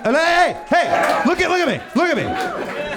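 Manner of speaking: high, showy look-at-me calls; bragging shout. Retold or imitated. Comedian mocks his college self, showing off high. Retells bragging about old mile.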